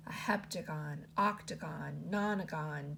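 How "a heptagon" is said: plain reading voice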